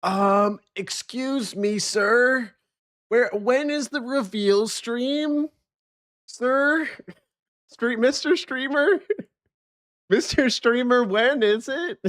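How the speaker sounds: high, prissy mock of a pestering chat viewer; high mocking voice of an impatient viewer demanding the reveal. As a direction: squeak it out like an impatient fan. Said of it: "Hal coming in here being like".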